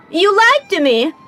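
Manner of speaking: put-on betrayed child shouting through hurt; hurt outburst. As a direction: throw the accusation back at him. Child hears the goodbye news.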